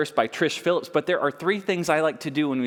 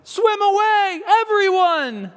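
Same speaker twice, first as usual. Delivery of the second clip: high loud warning cry; great cry; 6 dB louder, slower than usual. A narrator's reading. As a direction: urge everyone to flee. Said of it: "he heard a great cry".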